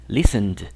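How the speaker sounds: LISTENED ending in "-nd", two syllables; correct D ending. Shown as a demonstration. Teacher modelling the voiced ending, listened as "lis-end". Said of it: "va a sonar más bien como una D".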